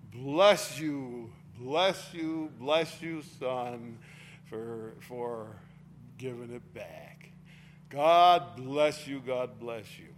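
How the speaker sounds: mother's blessing, hammed up and stretched out; gushing blessing. Mother gushingly blesses her son for returning the money, hammed-up. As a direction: pour blessings on the son, mock-tender. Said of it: "Now she says".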